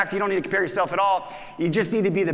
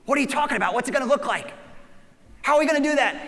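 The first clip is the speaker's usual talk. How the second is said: mock alarmed staff; 7 semitones higher, faster than usual. Team protests the change.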